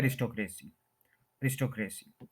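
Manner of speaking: "अरिस्टोक्रेसी" as a-ris-to-KRAY-see; common mispronunciation. Shown as a demonstration. Teacher quoting how we usually say it, mispronounced with kray. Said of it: "अरिस्टोक्रेसी नहीं बोलेंगे".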